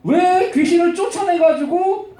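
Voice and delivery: high-pitched, indignant complaint, acted out; angry protest. Owner complains about losing his money-maker, angry protest. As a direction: protest it, stretching the 왜. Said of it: "화가 났어".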